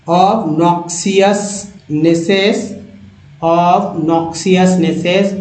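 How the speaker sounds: obnoxiousnesses stretched very slowly, syllable by syllable; teacher drawing out obnoxiousnesses; very slow read-along. Shown as a demonstration. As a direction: crawl through obnoxiousnesses so students can follow. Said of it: "मेरे साथ पढ़िए".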